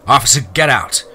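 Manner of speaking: loud, forceful dismissal; yelled order. A narrator's reading. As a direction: yell it, held within narration. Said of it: "the chief yelled".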